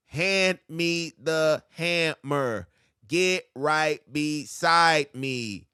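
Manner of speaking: each line drawn out slowly, heavy stress on first word; slow spoken breakdown. Shown as a demonstration. Teacher slowing bars down for students, drawn-out stress. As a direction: slowly spell out the rhythm. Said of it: "He just stacking syllables".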